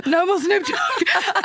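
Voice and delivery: high, slow, strained through laughter; strained laughing plea. Retold or imitated. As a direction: whimper it out like you're overwhelmed. DJ begging for less bass.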